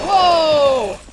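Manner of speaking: sudden loud, high whoa; startled yell. Real, not acted. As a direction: yelp it as if caught off guard. Startled by attack in game.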